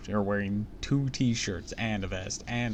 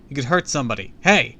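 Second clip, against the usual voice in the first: loud, raised scolding; yelled warning; 4 semitones higher, 10 dB louder than usual. A narrator's reading. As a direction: yell out to stop the rough play. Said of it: "I yelled".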